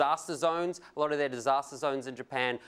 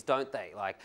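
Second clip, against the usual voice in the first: normal talking voice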